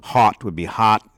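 "hot" said with a broad, open Minnesota o; Minnesota o vowel. Shown as a demonstration. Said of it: "the regional part of it is".

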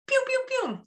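very high, playful pew-pew; cartoonish high-pitched spurts; squirting noises. Shown as a demonstration. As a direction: imitate little squirts in a squeaky pitch. Imitates squirts of love drugs.

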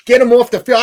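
a fan shouting at his TV during the game; yelling at the TV. Retold or imitated. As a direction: bark it like a fan fed up with his team. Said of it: "I'm yelling at the T V".

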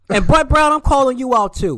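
loud, high call-out. Real, not acted. Fan calling out the coach, loud and high. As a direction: shout his name and call him out.

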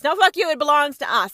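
loud, fast and furious; angry outburst. Acted. Playing China, yelling defiance at Britain. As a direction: spit it at the British like a threat.

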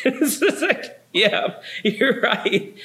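high, slow words through real laughter; laughing reply. Real, not acted. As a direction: laugh your way through the agreement. Laughing at the stranger's remark.

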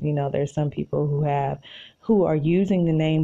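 ordinary talk, nothing marked

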